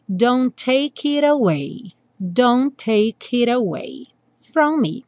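it away said "iɾ away", slow; flap T. Shown as a demonstration. Teacher models the flapped T, slowly. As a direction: turn the T of it into a quick tap before away. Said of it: "o T mudado pra rararã".

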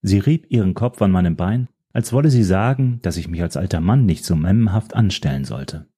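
ordinary delivery

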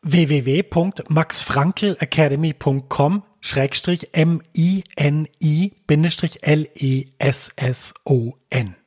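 slow, letter by letter; spelling it out. Shown as a demonstration. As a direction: spell each letter out clearly. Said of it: "Ich buchstabiere das mal kurz".

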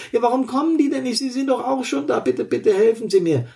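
high, frantic begging for help; desperate pleading. Acted. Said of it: "Die Perspektive des Verletzten".

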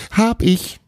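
very high, pleased exclamation; proud call. A narrator's reading. Magician owning up to his bear, proud call. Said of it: "rief Trapani stolz".

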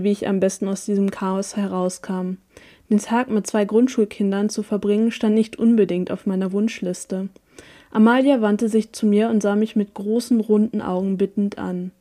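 ordinary delivery

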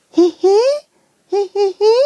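high creature giggling; giggle. Acted. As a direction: giggle like a happy little ball. Balli giggles.

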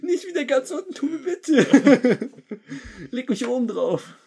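very high, slow, whiny plea; squeaky plate voice. Pretend. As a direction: whine like a tiny fussy plate. Plate voice pleading.

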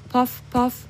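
high, soft popping sound from far away; muffled high poff poff; soft distant poff. A narrator's reading. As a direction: make a faraway pop.

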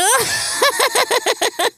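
high, crazed vulture cackle; insane laugh. A narrator's reading. Vulture Bakit suddenly laughing, crazed cackle. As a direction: cackle like a madman. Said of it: "lachte er plötzlich geradezu irrsinnig".

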